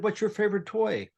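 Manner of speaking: put-on high, soft question to calm a scared girl; gentle voice to a child. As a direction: coax a frightened child. Chatting to the calmed girl.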